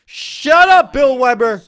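high, loud yell at the announcer; villain shout. Pretend. Villain yelling at the announcer, loud mock fury. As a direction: shout him down, playing the villain.

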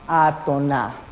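"atonal" drawn out low and slow in syllables; slow and syllabified. Shown as a demonstration. Teacher labels the unstressed syllable, slowly syllabified. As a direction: stretch each syllable of "atonal".

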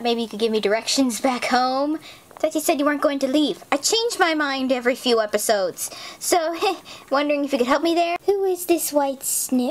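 usual speaking voice

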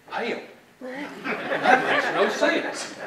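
slow, drawn-out Texas twang on Hell; a Texan scoffing, stretched out slow; Texan drawl. Retold or imitated. Speaker imitates how Texans say it, in a drawn-out Texas drawl. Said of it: "as they say in Texas".

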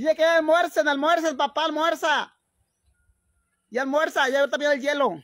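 high, loud call to come and eat; loud calling to the family. Acted. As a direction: shout it across the house so they come to lunch.

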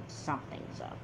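unvoiced whisper to herself; whispered aside. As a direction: whisper it to yourself, suspicious. Aside after daughter leaves.

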